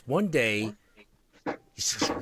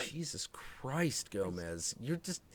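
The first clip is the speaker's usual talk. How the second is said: stunned murmur; 14 dB quieter than usual. Retold or imitated. Owner staring at the carnage, softly stunned. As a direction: breathe it out in quiet shock. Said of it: "And I'm just like".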